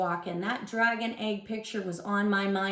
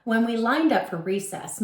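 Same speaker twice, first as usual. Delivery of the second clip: usual speaking voice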